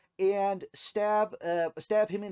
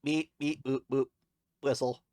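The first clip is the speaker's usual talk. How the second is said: droid beeps and boops; robot voice; 2 semitones lower than usual. Acted. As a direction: beep like a little droid. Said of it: "Robot, would you happen to have Rylac?".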